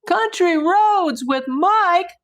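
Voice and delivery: high, loud, slow surprised read; excited. Real, not acted. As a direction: stretch the name out in delight. Reads donor name in surprise.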